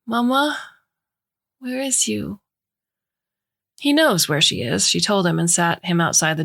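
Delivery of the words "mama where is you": put-on high, small child asking for mama; a little boy calling through the door; warbling toddler voice. Toddler calling for his mother outside the bathroom, warbling child voice. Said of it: "Jack's warbling voice".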